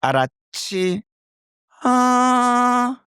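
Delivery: high, sung Korean hesitation "arachi, ah"; Korean song intonation. Retold or imitated. Tutor imitating Korean intonation, high and sung. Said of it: "I call it the Korean song".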